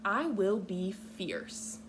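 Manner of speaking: ordinary talk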